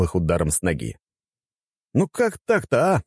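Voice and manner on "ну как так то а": high-pitched exasperated protest; mild outrage, pitched way up; indignant. A narrator's reading. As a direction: complain in a high, put-out voice. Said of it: "возмутился я".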